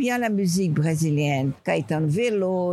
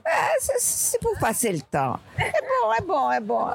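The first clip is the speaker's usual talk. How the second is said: laughing; 8 semitones higher than usual. Real, not acted. Laughs about the series.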